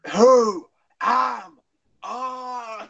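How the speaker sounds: loud, high anguished cry; Paul McGann. Acted. Failed Paul McGann attempt, high shouted wail. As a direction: wail each word, drawn out. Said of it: "I keep on shouting".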